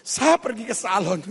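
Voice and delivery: hammed-up weepy protest; crying wife. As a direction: plead through mock sobs, rising as it goes. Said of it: "istrinya langsung menangis".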